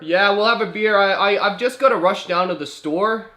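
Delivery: high, loud hammed-up agreement; friendly Canadian driver. Driver accepting a beer, loud friendly Canadian act. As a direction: call back like an agreeable mock Canadian.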